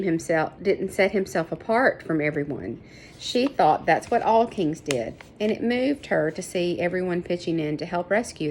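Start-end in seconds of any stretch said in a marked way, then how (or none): none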